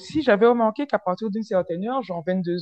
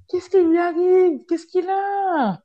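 much higher, slow stunned questions; shocked disbelief; 11 semitones higher, slower than usual. Retold or imitated. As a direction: sound floored by his move. Elle s'étonne du baiser. (étonnement choqué, voix beaucoup plus aiguë et lente)

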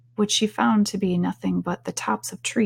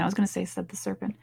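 ordinary delivery, nothing marked

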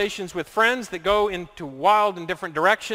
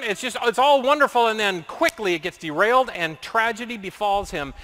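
usual speaking voice

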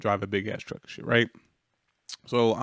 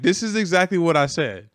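higher, louder insistence; indignant insistence; 6 semitones higher, 6 dB louder than usual. Retold or imitated. Driver to his boss, insisting loudly this is what he said. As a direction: push it out, defending yourself. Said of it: "I'm like".

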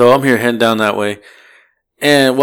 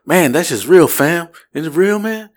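put-on higher-pitched drunk guy voice; drunk passenger imitation; 6 semitones higher than usual. Driver showing how his passenger said it, high drunk imitation. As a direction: imitate a drunk questioning what's real. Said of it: "this is how he said it".

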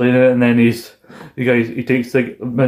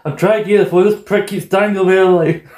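mock whine; 7 semitones higher than usual. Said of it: "I'm like".

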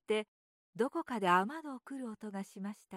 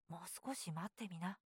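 put-on low, quiet older-brother voice; a drowsy question from bed; low sleepy brother; 5 semitones lower, 12 dB quieter than usual. A narrator's reading. Older brother in bed asks his little brother, low and quiet.

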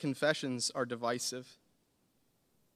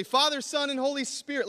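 loud, high call back; shouted answer; 10 semitones higher, 5 dB louder than usual. Pretend.

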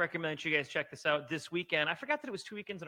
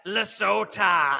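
drawn-out silly call; 9 dB louder, much slower than usual. Pretend.

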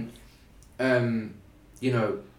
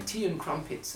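"Tea and crumpets" in a higher, mock posh English accent; 6 semitones higher, 5 dB quieter than usual. Mocking how Americans hear Brits, posh English accent. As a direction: lift into a mock upper-class English voice. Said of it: "he's saying".

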